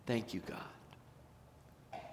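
slow whispered thank you; hushed slow prayer of thanks. Acted. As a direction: breathe the thanks softly. Grateful heart whispers thanks.

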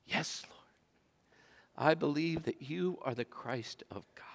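whispered confession, soft. Acted. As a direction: whisper it as a quiet vow. Martha answers Jesus, hushed.

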